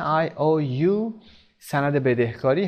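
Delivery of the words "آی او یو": آی او یو said as three separate letter names, slowly; read as letters. Shown as a demonstration. Teacher showing learners how the abbreviation is read, letter by letter. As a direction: spell out آی او یو letter by letter.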